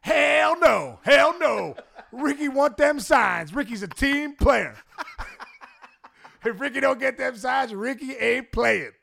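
high, slow third-person swagger; high-pitched Ricky voice, drawn out; Ricky Henderson impression. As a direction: play Ricky, defiant and sure of himself. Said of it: "Ricky's like".

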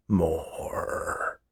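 low, very soft, drawn-out murmur; last faint sound. A narrator's reading. Sleeping old man's last sound, faint and low. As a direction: let it seep out as you pass out. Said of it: "sound pushed its way out".

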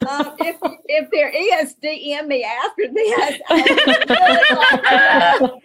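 high-pitched, giggly joking aside; laughing self-mockery. Real, not acted. Author joking to the hosts about having no cure, laughing. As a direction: joke at your own expense through laughter.

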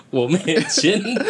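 drawn out, breaking into laughs; slow laughing confession. Retold or imitated. Boy admitting his sister signed, slow laughing confession. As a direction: confess it slowly, giggling.

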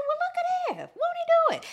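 gleeful church-lady praise; high mock exclaim. Fan reacting to new teeth, high gleeful exclaim. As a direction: squeal it high and loud.

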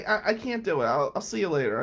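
ordinary talk, nothing marked

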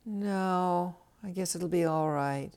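acted sighing, reluctant resignation. Friend turning down an offer of help, acted resigned sigh. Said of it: "your friend just sighs and says".

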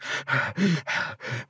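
panting, out-of-breath breaths; audible winded puffs; imitated heavy breathing. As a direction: act out catching your breath after running. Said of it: "breathing, you know".

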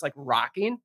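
ordinary delivery

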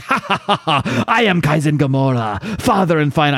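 villain laugh into a grand proclamation; cackle, then announce yourself like a hammy supervillain; Kaizen Gamora. Acted. Villain laughs at the UN envoys, mocking and grandiose. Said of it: "And he just laughs".

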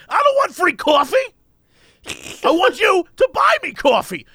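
high-pitched mock fury; hammed-up indignant demand with weighty pauses; imitated mad rant. Stranger refusing the free coffee, in mock high-pitched anger. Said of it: "he got so mad".

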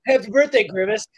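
a guy toasting before he explodes; mock cheery toast. Retelling a meme, the guy cheerily toasts Grimace. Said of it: "He goes like".

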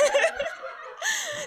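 bright burst of laughter; high laugh. Real, not acted. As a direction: laugh at yourself, high and sudden. Laughs after missing the train.

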